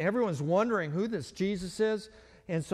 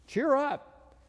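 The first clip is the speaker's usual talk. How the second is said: encouraging call; 8 semitones higher than usual. Retold or imitated. Crowd encourages the blind man.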